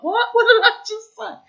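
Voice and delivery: squeaky high laughing what did I say; shocked at her own words, laughing; mock shock. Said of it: "You're like".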